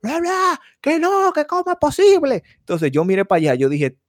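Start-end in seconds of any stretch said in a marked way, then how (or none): 0.0-2.4 much higher mock complaining voice; high-pitched parody of a ranting customer; mimicking the angry customer. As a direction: squeak out her complaint like the angry lady. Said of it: "esa mujer hablando dura en la Apple".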